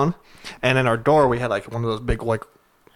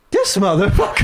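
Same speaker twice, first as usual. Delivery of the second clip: laughing disbelief; 6 semitones higher, 3 dB louder than usual. Retold or imitated. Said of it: "I'm like".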